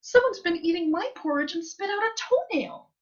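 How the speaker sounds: high little cub's complaint; wee little Baby Bear voice. A narrator's reading. Baby Bear looks at his porridge, wee little voice. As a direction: squeak it out as a tiny bear. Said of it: "in his wee little voice".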